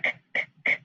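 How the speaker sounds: whispered hard k, k, k; isolated k sounds. Shown as a demonstration. Teacher isolating the k sound, crisp and whispered. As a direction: tap out the hard k sound three times. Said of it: "That's alliteration".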